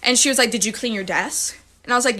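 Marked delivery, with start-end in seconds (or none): 0.5-1.6 lower, softer stern teacher question. Retold or imitated. Second grade teacher questioning a desperate kid, stern and low. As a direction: ask it like a cold, strict teacher.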